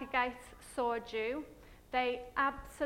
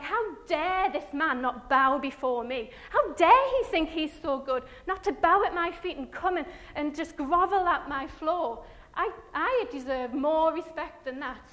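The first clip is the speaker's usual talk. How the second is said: furious Haman; 4 semitones higher, 9 dB louder than usual. Acted. Haman raging at Mordecai's refusal, loud furious rant. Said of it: "he was again furious".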